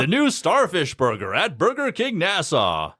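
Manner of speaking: high, slow commercial announcer read; Burger King ad. Shown as a demonstration. Demonstrating a Bahamas burger commercial, announcer voice. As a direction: sell it like a hammed-up radio ad. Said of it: "I do Burger King ads for the Bahamas".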